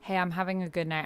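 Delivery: normal talking voice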